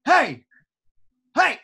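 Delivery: sharp calling out to someone; loud hail across a field. Retold or imitated. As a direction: call over a shoulder. Umpire calling out.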